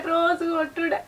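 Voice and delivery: wife repeating the daily beatings, sobbing and quiet. Acted. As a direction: let the repeat collapse into a sob.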